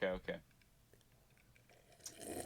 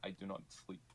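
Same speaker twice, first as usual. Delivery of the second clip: put-on Scottish accent; 9 dB quieter than usual. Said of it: "am I still doing the Scottish accent".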